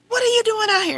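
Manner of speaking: high, loud, accusing question; catching mother outside at night; mad demand. Retold or imitated. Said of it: "I said".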